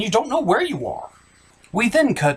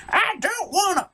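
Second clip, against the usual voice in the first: high woman's voice; 13 semitones higher than usual. Acted. Mother refuses the villain.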